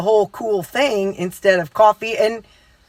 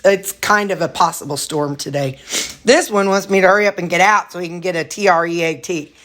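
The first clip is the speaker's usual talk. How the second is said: usual speaking voice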